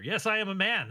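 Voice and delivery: ordinary talk, nothing marked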